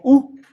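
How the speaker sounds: a plain Spanish U, pure oo; pronounced as U. Shown as a demonstration. Teacher gives the last diphthong rule, clear single U. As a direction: say U as one single oo vowel. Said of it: "Se pronuncia como".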